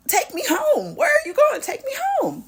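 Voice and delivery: panicked laughing shriek in the car; frantic scream. Retold or imitated. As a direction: scream to be taken home mid-fight.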